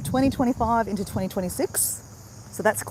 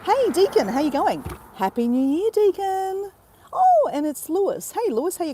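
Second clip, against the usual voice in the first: high, loud, cheery hello; excited greeting; 9 semitones higher, 4 dB louder than usual. Real, not acted. Greeting a viewer in the live chat, high and excited. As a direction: greet a friend across the room.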